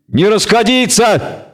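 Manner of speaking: high, slow barked command; barked order. Acted. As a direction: bark the order to hold. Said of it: "рявкнул Киврил".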